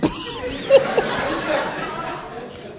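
bursting into high laughter. Real, not acted. Preacher cracking up at his own folk tale, high laugh.

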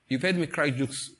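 usual speaking voice

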